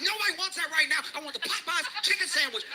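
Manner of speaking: high, laughing imitation of a customer; mock customer whine. Comic mimics a customer turning down Chick-fil-A, high laughing whine. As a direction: pitch it up like a spoiled fan. Voicing Popeyes-obsessed customers.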